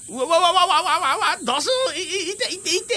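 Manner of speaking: high yelping, then ow ow ow; panicked falling cry. Acted. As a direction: shriek while tumbling out of a tree. 小天狗が木から落ちて痛がる. (高い悲鳴と痛がる声)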